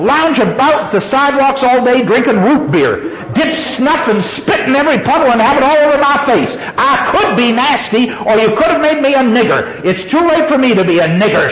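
high-pitched defiant sarcasm, read aloud; sarcastic. A narrator's reading. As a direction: taunt with what you could do. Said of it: "she said with deep sarcasm".

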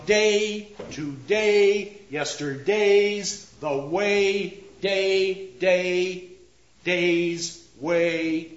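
"day", "days" and "way" stretched out on a long, held vowel. Shown as a demonstration. Lecturer shows internal rhymes, long drawn-out A sounds. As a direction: draw out the A in "day" and "way", pulling time out. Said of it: "Long vowel sounds again, the long A sound, pulling the time out".

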